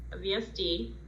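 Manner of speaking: ordinary delivery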